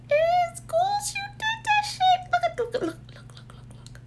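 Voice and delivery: very high squealing praise; squealing delight. Real, not acted. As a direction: gush over the packaging in a squeal. Gushing over pretty packaging.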